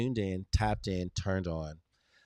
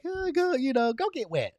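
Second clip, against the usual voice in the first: mock polite boss; 7 semitones higher than usual. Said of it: "politely be like".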